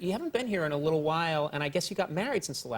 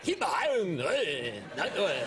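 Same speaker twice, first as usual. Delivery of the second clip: mock Scottish accent; slower than usual. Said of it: "I couldn't understand a word anybody's saying".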